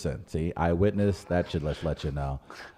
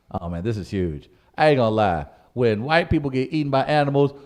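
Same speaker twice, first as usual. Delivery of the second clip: usual speaking voice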